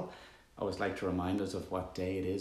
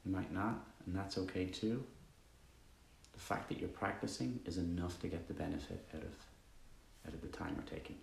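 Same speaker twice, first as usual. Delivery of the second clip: calm, even meditation voice with long pauses; guided meditation; 2 semitones lower, 8 dB quieter than usual. Acted. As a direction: guide listeners gently into stillness, leaving long silences.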